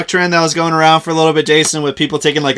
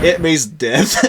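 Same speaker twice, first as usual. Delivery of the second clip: laughing; 4 dB quieter, slower than usual. Real, not acted. Laughing punchline about moaning.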